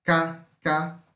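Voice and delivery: voiceless k snapped at the back; dry back-of-tongue k; K sound. Shown as a demonstration. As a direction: click out "K, K" from the throat. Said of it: "velar, surda".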